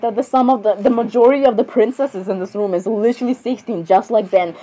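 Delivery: ordinary talk, nothing marked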